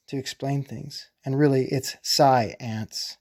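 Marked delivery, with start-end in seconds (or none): 1.2-3.2 slow, "sci-ance" pulled apart into two parts; sci-ance split. Shown as a demonstration. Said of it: "you don't know what's going on".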